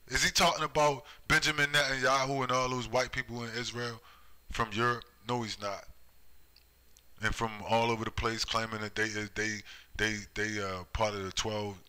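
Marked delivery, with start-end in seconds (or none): none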